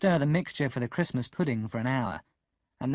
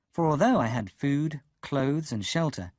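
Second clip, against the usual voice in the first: ordinary delivery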